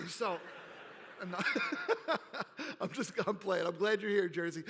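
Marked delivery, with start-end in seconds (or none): 0.4-2.8 laugh. Real, not acted. Laughs at own joke.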